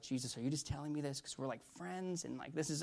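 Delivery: retold as a quiet, unsure question; hushed private prayer. Preacher turning to Jesus in his thoughts, hushed.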